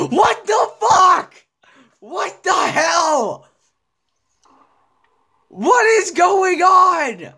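high, slow, crying-out rage; high drawn-out furious shout; anguished yell. Real, not acted. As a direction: wail at the screen in disbelief. Gamer dying, raging.

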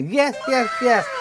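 usual speaking voice

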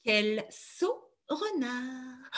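slow, drawn-out teasing; mocking mother crow. Mother crow mocking the foolish fox, slow teasing voice. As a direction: tease the fox slowly. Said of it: "la maman de Gilles fait et dit".

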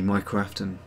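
ordinary delivery

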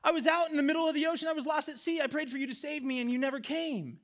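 high-pitched indignant complaint; acted aggrieved whine of a joke character; peeved, discouraged. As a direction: protest to God like someone badly let down. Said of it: "is peeved. He's, he's, like, pretty discouraged".

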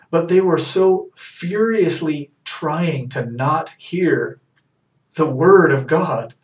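slow, drawn-out emphasis; slow emphatic. Real, not acted. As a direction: lean hard on each word. Preaching emphasis.

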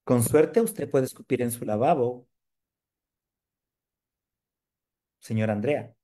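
same line, projected out. Shown as a demonstration. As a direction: say it again, fuller and to the room. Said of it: "repeats the line louder".

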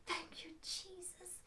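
hushed, voiceless thank you; whispered thanks. Acted. Healed woman thanking Jesus in a whisper. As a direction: breathe a secret thanks.

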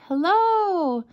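high, long echoing hello; drawn-out echo of a call, lightly voiced. A narrator's reading. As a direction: imitate an echo coming back. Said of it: "came back his echo".

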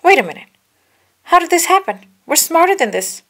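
high, loud puzzled outburst; Obi-Wan surprised. A narrator's reading. Obi-Wan realizes they are trapped, high and loud. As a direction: sound baffled at the trap. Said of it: "Obi-Wan said".

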